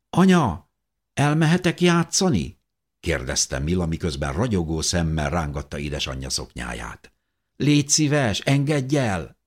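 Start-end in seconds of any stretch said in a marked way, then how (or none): 0.1-2.6 put-on high, eager child voice; much higher child's voice asking mum; little girl. A narrator's reading. Little girl asks her mother. 7.6-9.4 put-on high child's plea; high-pitched, coaxing child; pleading little girl. A narrator's reading. Little girl begs her mother.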